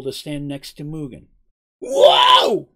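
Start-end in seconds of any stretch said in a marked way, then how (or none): 1.8-2.7 very high, loud yelp of surprise; startled cry. A narrator's reading. Mugen as Seraph rushes up beside him, crying out. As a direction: cry out as if jumped at. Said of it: "cried out Mugen".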